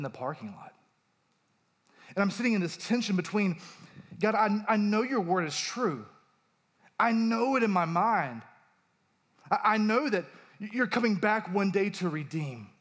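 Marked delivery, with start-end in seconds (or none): 4.2-12.7 raised, higher-pitched plea to God; pleading prayer. Retold or imitated. Preacher retelling his parking-lot prayer after a death, pleading. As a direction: recall praying hard, insisting on belief.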